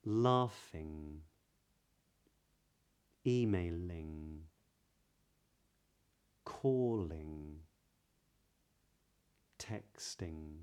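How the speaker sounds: slow drill of ing endings; modern british accent. Shown as a demonstration. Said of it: "some I N G suffix words".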